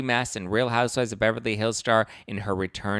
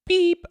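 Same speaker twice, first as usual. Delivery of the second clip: imitated beep; 8 dB louder than usual. Self-checkout machine beeping.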